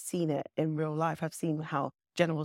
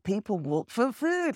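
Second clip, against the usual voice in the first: higher, slow, understanding at last; the penny drops, slowly; dawning realization; 6 semitones higher, slower than usual. Retold or imitated. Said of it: "And then now I'm like, "Oh".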